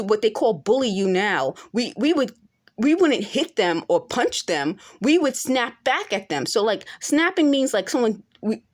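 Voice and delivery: ordinary talk, nothing marked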